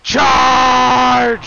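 drawn-out high shouted charge; battle cry. Pretend. Game king sending knights into battle, shouted battle cry.

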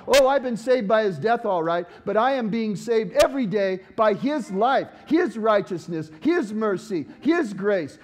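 loud, fervent preaching; ringing sermon voice building loud; full force preaching. Acted. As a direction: preach it out to the whole room.

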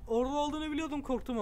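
jumping at the neighbor; startled outburst. Real, not acted. Player spotting the neighbor, startled outburst.

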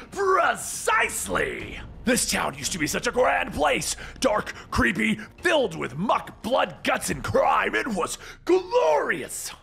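manic high-pitched villain rant; shouting Joker. A narrator's reading. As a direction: rave theatrically, soaring on the exclamations. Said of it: "And the Joker shouts".

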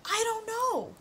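high, whiny I don't know; exasperated. Real, not acted. Game won't take the name, she whines high. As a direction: throw up your hands.

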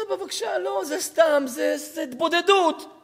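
acted upset daughter. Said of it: "למה את צועקת?".

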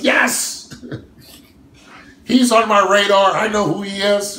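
high, loud mock enthusiasm with a laugh; mock eager yes. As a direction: ham up a rival suddenly remembering you. Imitating Phil's answer about him.

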